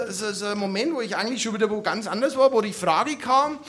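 ordinary delivery

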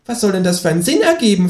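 high-pitched kangaroo question; expectant kangaroo. Acted. Kangaroo waits for the narrator's oath, high prodding creature voice. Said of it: "fragt das Känguru".

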